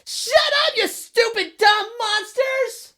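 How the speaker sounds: very high, loud, drawn-out sing-song yell; shout. Retold or imitated. Dad retelling his son yelling into the bathroom at monsters, high sing-song shout. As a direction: belt it at the monsters like a fearless kid. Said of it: "just shout".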